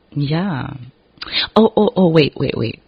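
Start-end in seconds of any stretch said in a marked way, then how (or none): 1.5-2.8 sudden excitement. Real, not acted. Suddenly remembers something.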